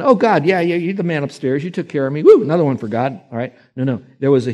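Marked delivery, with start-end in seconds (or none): 0.0-3.2 high, breezy, offhand thanks; flippant. Retold or imitated. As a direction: toss off a casual thank-you. Mocking casual thankfulness.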